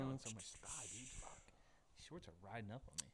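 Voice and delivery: whispered, fast complaint; muttered aside. Real, not acted. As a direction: mutter it under your breath quickly. Aside while adjusting shorts.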